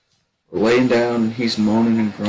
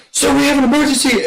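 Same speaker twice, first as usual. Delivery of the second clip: high fast urgent call; urgent report; 14 semitones higher, faster than usual. Retold or imitated. Recruit alerting the drill instructor, urgent and fast. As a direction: blurt the emergency out.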